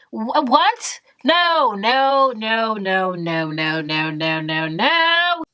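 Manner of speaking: dramatic string of no's; exaggerated refusal. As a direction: slide down in pitch and jump back up at the end. Mock refusal of the move-in.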